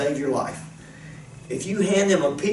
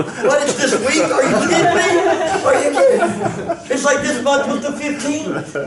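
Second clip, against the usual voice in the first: laughing at himself; 11 semitones higher, 4 dB louder than usual. Retold or imitated. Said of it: "I'm going".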